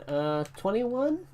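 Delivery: high, slow, clueless answer; mock dopey kid from the meme; dumb kid voice. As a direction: drag out twenty-one like a dim kid. Acting the nine plus ten meme.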